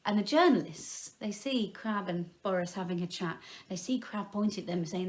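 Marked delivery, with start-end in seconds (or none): none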